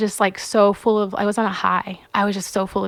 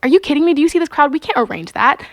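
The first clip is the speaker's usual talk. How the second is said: incredulous; 6 semitones higher, 4 dB louder, faster than usual. Retold or imitated. Team refuses incredulously.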